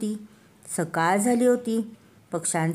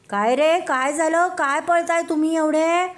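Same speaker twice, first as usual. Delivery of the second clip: curious monkey; 9 semitones higher than usual. Acted. Monkey in the tree asking the runners why, high call. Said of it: "माकड म्हणालं".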